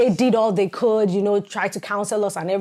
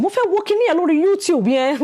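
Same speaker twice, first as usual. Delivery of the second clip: Yoruba retold quote; 8 semitones higher, 3 dB louder than usual. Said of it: "I was like".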